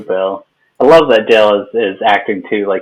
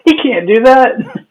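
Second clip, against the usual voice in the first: high, slow, loud disbelief; mock outrage; 5 dB louder than usual. Co-host voicing Hank's shock, high drawn-out protest. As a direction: imitate a scandalized rule-follower.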